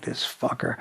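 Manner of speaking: whispering while waiting for the daughter's name; under-the-breath whisper. Retold or imitated. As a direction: mutter it under your breath in disbelief.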